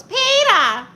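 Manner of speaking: shrill high yell of a cartoon wife calling out; Lois. Aunt answering a viewer with a cartoon wife's loud high call. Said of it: "You sound like Lois".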